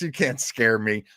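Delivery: ordinary talk, nothing marked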